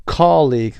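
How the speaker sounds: "Colleague" with COL- high and loud; stress on first syllable. Shown as a demonstration. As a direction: punch the start of "Colleague". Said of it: "قسمت اول".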